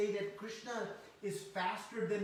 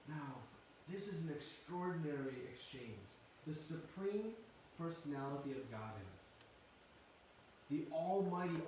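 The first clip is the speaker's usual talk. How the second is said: ordinary delivery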